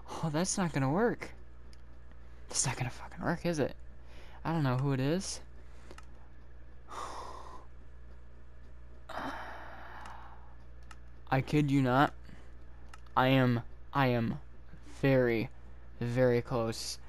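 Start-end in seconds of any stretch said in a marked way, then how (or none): 5.4-11.2 long breathy exasperated sigh; drawn-out weary sigh. Real, not acted. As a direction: exhale all the way out in defeat.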